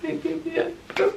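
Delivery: high, soft, tearful break on passed; the words catch as the tears come. Real, not acted. Mother reliving the baby's collapse, voice breaking. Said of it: "No, I'm not gonna cry".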